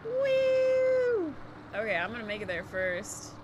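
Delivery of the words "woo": loud, high, long woo; excited whoop. Real, not acted. Gamer racing off, loud high whoop. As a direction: let out a thrilled cheer. Cheering while driving.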